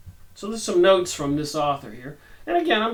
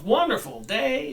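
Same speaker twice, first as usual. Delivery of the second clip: ordinary delivery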